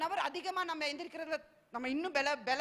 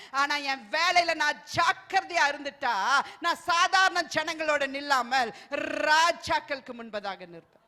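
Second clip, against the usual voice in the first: slow, louder rising declaration; full-force preacher proclaiming slowly; preaching; 8 dB louder, slower than usual. Acted. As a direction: lift the promise up to the congregation. Contrast: diligent worker.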